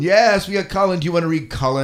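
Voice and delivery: loud, high, excited cheer. Real, not acted. Host thrilled to get a note from beloved Colin, excited. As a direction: greet the news with loud delight.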